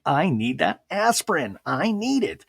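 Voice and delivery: high pleading whine; mock desperate mom asking for aspirin; whiny mom plea. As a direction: beg like a needy mother.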